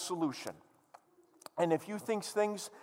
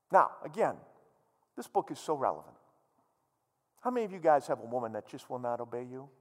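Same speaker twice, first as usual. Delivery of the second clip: normal talking voice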